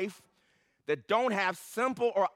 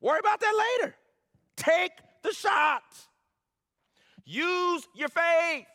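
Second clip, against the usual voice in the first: preaching shout; 9 semitones higher, 4 dB louder than usual. Acted. Preacher urging his congregation to act, loud and high. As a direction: cry it out to the church.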